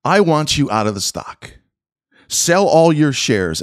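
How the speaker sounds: slow, deliberate quoted order; nice and slow. Shown as a demonstration. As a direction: spell it out word by word. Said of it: "let me repeat it nice and slow".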